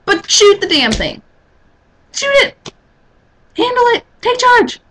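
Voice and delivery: high-pitched, insistent orders; urgent commands. Pretend. Woman ordering a timid man facing a pig, insistent.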